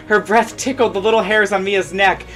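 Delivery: usual speaking voice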